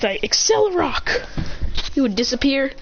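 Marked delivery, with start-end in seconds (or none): 0.2-1.3 high, drawn-out move cry; battle command. Acted. Trainer ordering Lycanroc to attack, high battle cry. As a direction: stretch the move name out up high.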